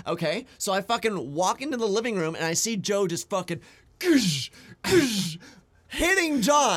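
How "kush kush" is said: punch sound effects. Retold or imitated.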